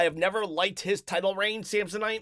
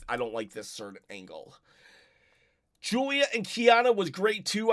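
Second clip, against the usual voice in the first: ordinary talk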